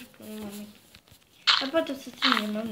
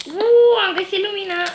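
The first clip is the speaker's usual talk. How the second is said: loud, high whoop of delight; real excited cheer, pitched high; excited discovery; 7 semitones higher, 8 dB louder than usual. Real, not acted. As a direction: burst out thrilled at the find. Cheering a find in the game.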